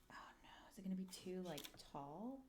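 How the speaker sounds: fast anxious whisper; worried whisper. Real, not acted. Whispering to chat, scared the part is too tall. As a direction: breathe it out in a nervous hush. Worried while fitting the clock.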